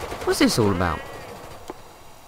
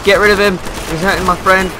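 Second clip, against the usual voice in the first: urgent shout; 8 semitones higher, 4 dB louder than usual. Real, not acted. Teammate under attack.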